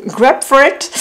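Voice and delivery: Grapefruit said the English way, /ɡapfit/, with a laugh; English accent. Shown as a demonstration. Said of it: "Désolée pour mon super accent, euh, English". (accent anglais sur Grapefruit, en riant)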